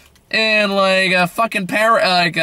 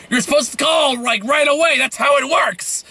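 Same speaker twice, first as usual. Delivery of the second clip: higher-pitched upset complaint; upset father; 7 semitones higher than usual. Retold or imitated. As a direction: imitate an indignant scolding over a missed call. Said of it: "He's upset they didn't call him".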